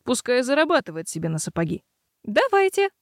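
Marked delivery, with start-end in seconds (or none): 2.2-2.9 eager. A narrator's reading. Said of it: "с готовностью подскочила".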